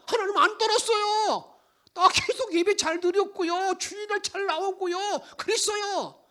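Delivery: high, loud indignant protest; protesting people. Acted. The people protesting to God, high and indignant. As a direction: argue back, offended. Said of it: "백성들이 이렇게 말합니다".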